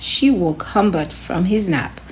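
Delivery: plain reading voice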